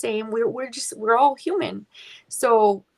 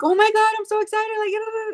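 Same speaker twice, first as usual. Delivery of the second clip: mock excitement; 12 semitones higher, 4 dB louder than usual. Singer showing how you'd think she'd react, mock over-excited squeal. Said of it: "you would think that I would be like".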